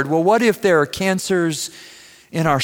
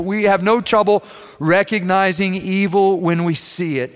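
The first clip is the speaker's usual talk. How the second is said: ordinary talk, nothing marked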